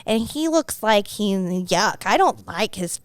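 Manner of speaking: put-on toddler-like, drawn-out judging of a stranger; cute baby voice. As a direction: play a small child passing slow, innocent insults. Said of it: "Like a cute little baby boy".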